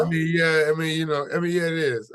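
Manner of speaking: modestly admitting it is a big deal; bashful. Real, not acted. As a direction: laugh it off, embarrassed. Bashful reply to praise.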